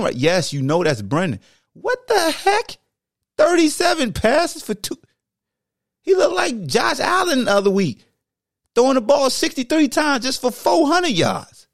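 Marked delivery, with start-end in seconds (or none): 1.7-11.7 high, stretched "what the heck" then a shout; exasperated yell at the passing numbers; incredulous rant; outraged disbelief. Real, not acted.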